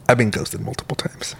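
whispered confession; whispering. Real, not acted. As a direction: drop to a whisper. Confessing aside between friends.

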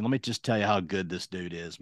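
usual speaking voice

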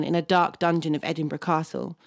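ordinary talk

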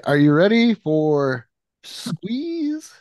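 slow, high hyped announcer call; announcer hype. Acted. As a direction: build it up like a ring announcer. Hyping the next figure.

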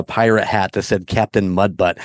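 usual speaking voice

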